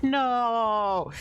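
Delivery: long, high-pitched mock "no" wail; mock dismayed cry. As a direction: cry out in hammed-up protest. Acts out character losing powers.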